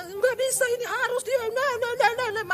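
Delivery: high-pitched, insistent mock refusal; mock stubborn protest. Preacher mimicking believers who will not let go, mock-indignant.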